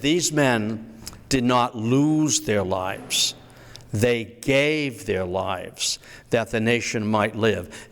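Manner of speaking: slow, each word weighed like scripture; slow solemn recitation. Retold or imitated. Historian quoting Lincoln to the audience, slow and solemn. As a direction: read it slowly and solemnly, honoring the dead. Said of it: "He said".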